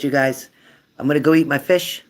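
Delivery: ordinary delivery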